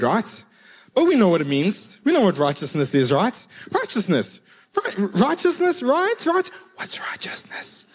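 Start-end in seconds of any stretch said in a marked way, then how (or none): 4.7-6.5 very high, squeaky questioning; hammed-up unsure squeak; mock uncertainty. Mock unsure congregation checking itself. 6.8-7.8 whispered mock-puzzled aside; hushed, voiceless question; confused whisper. Mock confused aside after bluffing.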